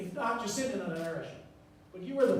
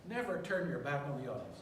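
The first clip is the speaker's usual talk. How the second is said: usual speaking voice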